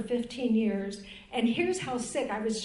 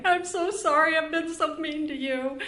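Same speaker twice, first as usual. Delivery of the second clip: higher, louder, tearful pleading; replaying a tearful sorry, pitched up; retold crying apology; 5 semitones higher, 4 dB louder than usual. Wife apologizing in tears to her husband at the hospital, a retold cry. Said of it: "and now I'm crying".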